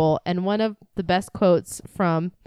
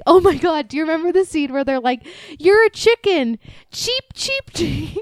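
excited retelling; 9 semitones higher, 5 dB louder than usual. Retold or imitated.

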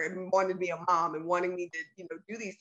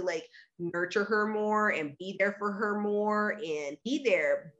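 ordinary talk

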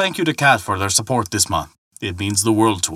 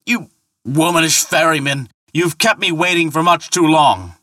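higher, loud exasperated yell; impatient angry shout; 8 semitones higher, 3 dB louder than usual. Acted. Thor yelling impatiently at the ferryman who keeps him waiting. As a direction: snap at him for the delay.

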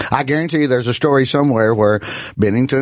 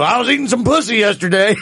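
a light, higher Muppet frog voice on a dirty line; lightly imitating Kermit for a laugh; 8 semitones higher than usual. Host mocks a clean comic going dirty, in a light Kermit voice. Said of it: "like hearing Kermit the Frog go".

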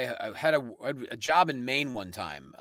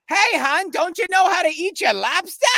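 imitated Maine accent, much higher and louder; 5 dB louder than usual. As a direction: ham up an old Maine lady's accent, calling out high. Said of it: "strongest, most beautiful Maine accent ever".

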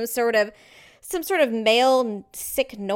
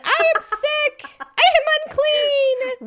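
slow, high, loud proclamation; hammed-up wailing announcement; mock declaration; 7 dB louder, slower than usual. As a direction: declare it dramatically like a public outcast. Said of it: "you know, declare".

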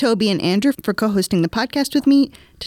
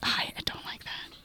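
whispering; 16 dB quieter than usual. Pretend. Whispered reply.

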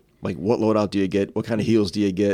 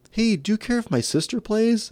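put-on kid voice; 12 semitones higher than usual. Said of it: "the one kid is like".

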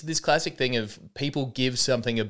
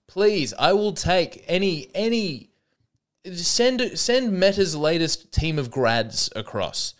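mock pleading; 6 semitones higher, slower than usual. Begging Meta to send staff.

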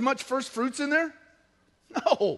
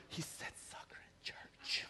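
slow scandalized whisper; mock shocked whisper; 24 dB quieter, slower than usual. Imitating shocked congregation members.

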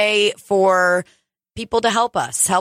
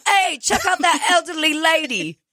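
high, mock British voice; British accent; 8 semitones higher than usual. Co-host mimicking the quote as British, British accent. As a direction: echo it in a put-on British accent. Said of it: "you were doing a British accent".

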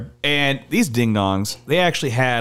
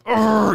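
high mock growl of rage; angry growl; 9 semitones higher, 3 dB louder than usual. Thief getting all angry when caught, angry growl. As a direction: imitate a guy getting all worked up. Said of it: "you know, like, getting all angry".

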